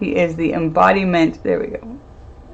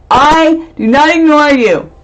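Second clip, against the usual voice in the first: playful protest; 8 semitones higher, 7 dB louder, slower than usual. Real, not acted. Defending herself against a viewer.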